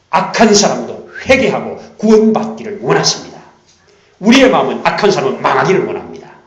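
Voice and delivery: loud, driving contrast; preaching at full force. Acted. Preacher proclaiming God's will to the congregation. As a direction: hammer the contrast home.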